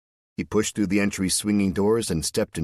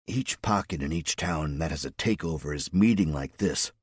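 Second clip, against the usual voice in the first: plain reading voice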